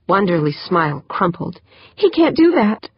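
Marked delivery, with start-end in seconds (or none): 2.0-2.9 high-pitched, appalled protest; dismayed. Acted. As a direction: protest in sudden alarm. Smile crumples at the news.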